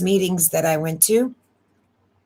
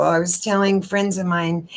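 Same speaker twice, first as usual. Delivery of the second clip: ordinary talk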